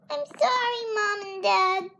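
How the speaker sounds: put-on a child crying out her apology to her parents; cried out. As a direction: cry it out high like a sorry little girl. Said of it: "she cried".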